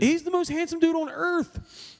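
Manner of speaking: high, drawn-out gushing. Real, not acted. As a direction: gush in a high, stretched-out voice. Gushing about a celebrity crush.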